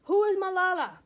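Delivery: high, loud threatening yell. Acted. Second gunman yells at the schoolgirls, loud and high. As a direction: bark it out to frighten the girls. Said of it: "enters the bus and yells".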